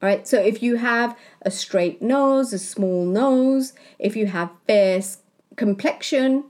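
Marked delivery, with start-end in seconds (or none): none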